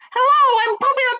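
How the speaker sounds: very high, loud cartoon penguin; very high squeaky penguin introducing herself. Recording a voice for penguin.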